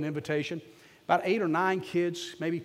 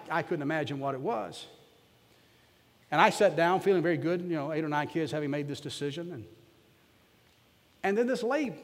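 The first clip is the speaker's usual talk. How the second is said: ordinary delivery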